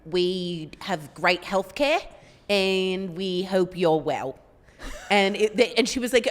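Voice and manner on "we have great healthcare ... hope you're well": put-on very slow, stretched-out New Zealand accent. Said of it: "here's my New Zealand accent".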